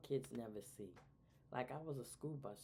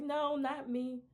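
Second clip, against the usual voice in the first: son's denial; 12 semitones higher, 10 dB louder, slower than usual. Retold or imitated. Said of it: "He was like".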